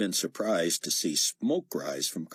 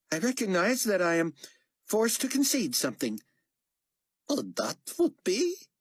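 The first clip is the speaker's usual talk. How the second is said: character voice; 13 semitones higher than usual. Acted. Negotiation dialogue voiced.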